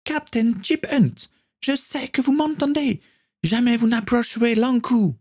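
high, sustained acted yell. A narrator's reading. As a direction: cry out high in a captive girl's voice. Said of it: "une voix familière se mit à hurler".

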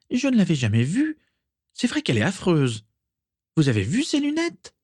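high-pitched catty remarks; lightly acted scorn, pitched well up; mocking gossip. A narrator's reading. Isalia ridiculing Mimi's glasses to the group, mocking.

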